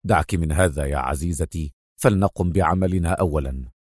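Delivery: low, controlled hero voice; deep, restrained and businesslike; Adham. A narrator's reading. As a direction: brush off the concern in a deep voice. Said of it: "هتف بها أدهم".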